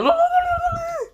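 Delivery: very high turkey gobble; turkey scream. Acted. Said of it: "screaming like a turkey".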